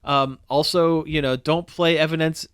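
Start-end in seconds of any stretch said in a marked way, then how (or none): none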